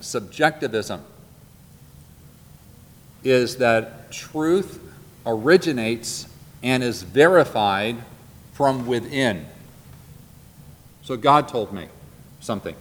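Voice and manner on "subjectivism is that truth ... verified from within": said again very slowly, with pauses between the phrases; slow, deliberate restatement. Shown as a demonstration. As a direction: spell out "truth" and "verified" carefully, word by word. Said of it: "Let me say that again".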